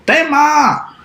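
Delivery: llamando alto al hijo; llamada del papá. Acted. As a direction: grita el nombre desde lejos. (el papá llama a Tema en voz alta)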